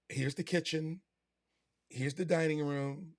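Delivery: mock tour-guide recital. Agent showing clients round room by room, mock tour-guide recital. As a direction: imitate an agent pointing out the obvious.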